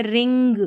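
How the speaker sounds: रिंग with nasal n plus audible ग; ng sounded as अंग. Shown as a demonstration. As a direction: sound both the half न and the ग in रिंग. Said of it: "अंग करके इसका उच्चारण".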